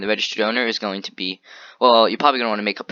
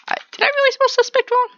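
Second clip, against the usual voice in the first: much higher, incredulous squeak at his own typo; surprised; 5 dB louder than usual. Real, not acted. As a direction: catch yourself out in high disbelief. Noticing own spelling mistake.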